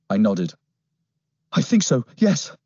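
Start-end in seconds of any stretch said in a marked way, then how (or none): 1.5-2.5 put-on higher, shaken boy's voice; young boy voice. A narrator's reading. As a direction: answer weakly but willing.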